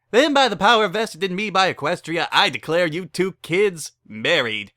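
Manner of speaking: high, loud ceremonial pronouncement; grand officiant. Acted. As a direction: pronounce them married with pomp.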